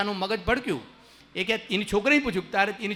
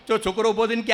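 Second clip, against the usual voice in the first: ordinary delivery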